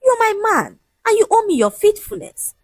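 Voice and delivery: high-pitched, insistent claim; indignant insistence. Acted. Fiancée claiming her man's loyalty, indignant.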